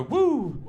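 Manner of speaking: high-pitched "woo" cheer; high crowd-prompting whoop. Shown as a demonstration. Cueing the audience to cheer.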